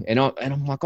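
ordinary delivery

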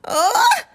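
very high, loud squeaked grunt of frustration; exasperated squeak. Acted. Girl squeaking in frustration at the pestering boy. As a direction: squeak out an annoyed ugh. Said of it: "she squeaked".

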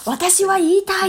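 high-pitched campaign speech voice; rally speech. Pretend. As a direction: declaim it like a candidate on a sound truck. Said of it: "広報したいですもんね". (選挙演説風の高い声)

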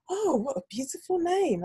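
high, gushing mock admiration; put-on high-pitched compliment; imitating teacher. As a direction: mimic a teacher cooing over a name. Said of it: "She was like".

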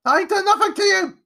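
very high, loud panicked shrieking; desperate boy screaming; terrified cry. A narrator's reading. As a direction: shout frantically at a ghost. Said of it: "he cried".

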